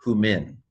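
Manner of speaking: "humin" said "hoo-min", clear h; conventional classroom pronunciation. Shown as a demonstration. Teaching New Testament Greek, "humin" as "hoo-min". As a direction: say "humin" with oo and short i. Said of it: "in teaching New Testament Greek".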